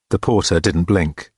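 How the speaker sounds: ordinary delivery, nothing marked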